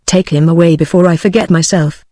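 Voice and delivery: plain reading voice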